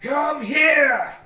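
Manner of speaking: high, loud bellow heard across town; big bard's holler; booming shout. A narrator's reading. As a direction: boom it out for all to hear. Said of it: "hear his voice in a moment booming out".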